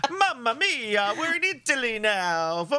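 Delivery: bad fake Italian accent for laughs; mock Italian accent. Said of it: "complain about my dodgy accents".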